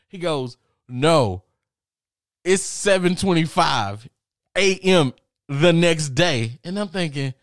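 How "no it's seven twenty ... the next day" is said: loud, high, drawn-out announcement; exasperated roommate, each word stretched; emphatic correction. Acted. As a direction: spell it out slowly at full volume. Roommate corrects him on the time.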